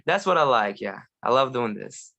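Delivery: normal talking voice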